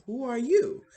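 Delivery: a zombie waking up startled; startled call. Acted. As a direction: blurt it out, high and loud.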